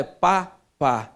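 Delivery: "pá, pá" como sílabas de bebê, o segundo "pá" mais grave; imitando o balbucio. Shown as a demonstration. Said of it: "primeiras sílabas que ela fala". (imitando o bebê: "pá, pá")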